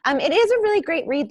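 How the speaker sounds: playful plug to publishers; excited plug; high, loud sales pitch. Real, not acted. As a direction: pitch the book brightly and loudly. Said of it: "wants to pick up my book".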